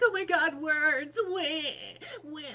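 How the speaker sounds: loud high whiny mockery; mock a crybaby fussing over words; mocking whiners. Mocking people upset by naughty words, loud whiny wail. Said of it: "people weren't like".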